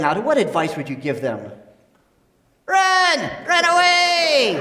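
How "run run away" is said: high squeaky yell; shouting dummy. Acted. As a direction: shout it like a panicked puppet. Dummy yells advice.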